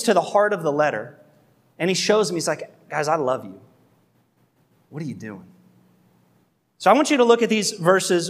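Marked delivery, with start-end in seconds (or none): 2.9-5.5 softer, lower, gentle heartfelt question; a calm parent asking with love; tender plea. Retold or imitated. Paul sitting with the Galatians, gently pleading.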